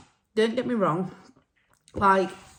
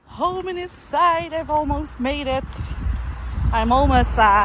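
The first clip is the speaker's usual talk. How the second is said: relieved triumph; 7 semitones higher, 6 dB louder than usual. Real, not acted. Walker with home almost in reach, triumphant. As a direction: push it out high and loud. Nearly home at end of walk.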